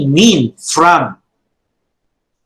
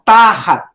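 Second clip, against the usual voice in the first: Tahat with a long, strong first syllable; stress on first syllable; 4 semitones higher, 4 dB louder than usual. Shown as a demonstration. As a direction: lean hard on the Ta in Tahat, let hat drop. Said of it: "Notice the pronunciation".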